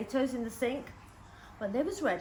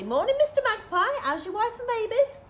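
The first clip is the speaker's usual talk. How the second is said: higher, louder sing-song hello; cheery greeting; 10 semitones higher, 7 dB louder than usual. Acted. Child prompted to greet the magpie, high and cheery. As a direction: call up to the bird politely.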